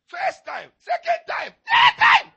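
climbing from loud to very high, very loud shout; building to a shout. Acted. Building count, rising to shout.

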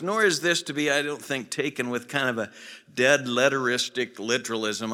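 loud, high, ringing pulpit voice; full-force preaching. Acted. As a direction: preach it out to the back pews with fire.

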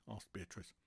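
plain reading voice

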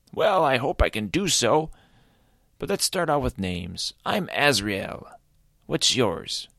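high, cheerful creature voice; friendly creature. Acted. Azrael introducing himself to Caleb, high and cheerful. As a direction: introduce yourself up high and bright.

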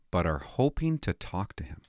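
hushed, sneering quote; mock the chief's statement under the breath; mocking whisper. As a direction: whisper it as a ridiculous line.

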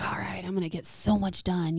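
soft unvoiced whisper. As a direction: whisper it to yourself. Inner thought, whispered.

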